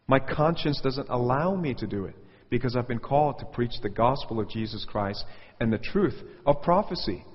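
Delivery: normal talking voice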